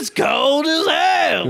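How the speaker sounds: very high, drawn-out hammed-up holler. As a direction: holler a crude caricature, far above your own pitch.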